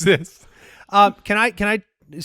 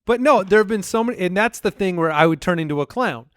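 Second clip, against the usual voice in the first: normal talking voice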